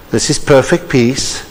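ordinary talk, nothing marked

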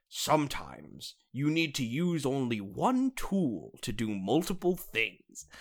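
slow, over-enunciated imitation of a moral; mock-wise lesson. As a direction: hand down a cartoon lesson with mock gravity. Quotes the show's solemn lesson.

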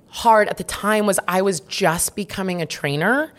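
normal talking voice

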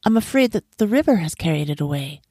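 high, apologetic lament easing down; sigh, then explain apologetically; mildly rueful woman; sighing regret. A narrator's reading. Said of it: "the woman sighed and said".

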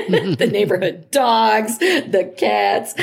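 laughing through the words, lightly delighted. Real, not acted. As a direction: let a real giggle break in over the animals.

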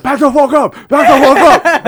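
very loud, high-pitched retold yell; warning shout. Cornered man to the angry mob, full-volume warning shout. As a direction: bellow to drive a crowd away. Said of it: "getting super angry".

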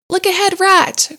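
high, loud alarmed warning; sudden warning cry. A narrator's reading. Mole warning rat of the bank, alarmed cry. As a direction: yell a warning before the crash. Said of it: "cried the mole suddenly".

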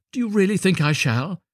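high, naive question; hopeful little Hans. A narrator's reading. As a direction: ask eagerly, pitched high. Hans asks the miller hopefully.

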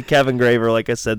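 normal talking voice

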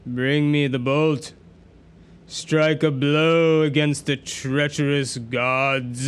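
hungrier evil voice. Acted. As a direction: demand it hungrily. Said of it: "The voice became hungrier".

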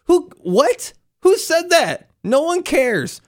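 outraged who-said-that squeak; incredulous. Real, not acted. Incredulous rant about mask refusers.

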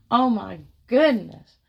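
loud thrilled Oh my goodness; excited exclamation. Real, not acted. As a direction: belt it out in delight. Repeated exclamation, second take.

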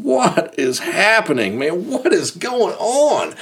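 slow, high, incredulous; drawn out in pure disbelief; stunned disbelief. Retold or imitated. Friends stunned by the arrest threat, slow and high.

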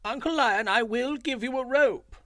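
high, loud fawning jackal voice; sly jackal. A narrator's reading. Jackal agrees to Uncle Lion, high and fawning. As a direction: play the crafty little jackal, all eager to please.

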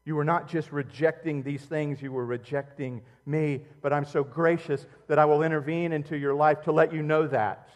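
ordinary delivery

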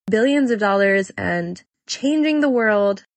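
ordinary delivery

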